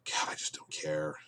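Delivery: whispered, soft and drained; weary whisper. Real, not acted. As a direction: sigh it out as if it's not worth the breath. Bored by the plot.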